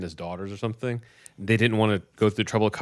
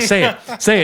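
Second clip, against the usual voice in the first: high, loud mock command; urgent mom's order; 10 semitones higher, 7 dB louder than usual. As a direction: imitate a frantic mother insisting. Mom orders son to recite.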